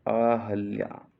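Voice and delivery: ordinary delivery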